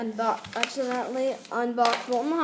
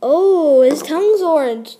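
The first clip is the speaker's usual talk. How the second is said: loud, amazed discovery; excited loud exclamation; 2 semitones higher, 10 dB louder than usual. Real, not acted. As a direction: shout it out in delight. Gasps at the toy.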